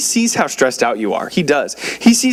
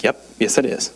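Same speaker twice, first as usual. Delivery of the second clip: wife's flat reply; 5 semitones lower than usual. Retold or imitated. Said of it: "And she's like".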